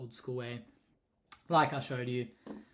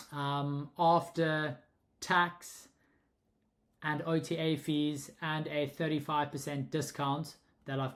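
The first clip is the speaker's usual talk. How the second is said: measured speech to a machine listener; dictating to a voice assistant. Real, not acted. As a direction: spell it out slowly so the app catches every word. Dictating prompt to ChatGPT voice.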